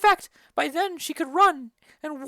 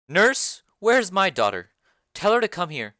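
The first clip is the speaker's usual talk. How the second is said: low, commanding lady of the house; a noble mother summoning the nurse, lower; Lady Capulet; 9 semitones lower, 5 dB louder than usual. Acted. Lady Capulet calls the nurse for her daughter, low and commanding. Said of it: "Lady Capulet and the nurse enter".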